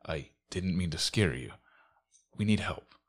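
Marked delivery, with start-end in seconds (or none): none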